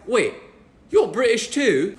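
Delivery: surprised British stranger, imitated; British accent. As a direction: imitate a Brit taken by surprise. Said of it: "expect them to have an accent".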